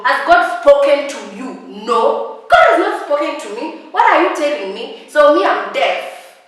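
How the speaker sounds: fed-up shout; indignant loud. Real, not acted. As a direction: demand an answer, outraged. Indignant denial.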